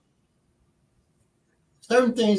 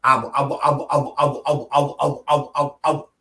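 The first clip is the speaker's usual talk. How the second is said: rapid-fire stuttered repeats; imitated stutter; 3 semitones lower than usual. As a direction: get stuck on the same syllable over and over. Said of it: "He said".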